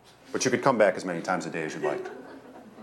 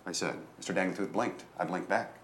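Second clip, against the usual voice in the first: plain reading voice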